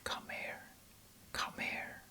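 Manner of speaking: breathy whispered come here, no voice at all. Retold or imitated. Woman beckoning him to bed in a whisper. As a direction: coax him over in a hush. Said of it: "And she's like".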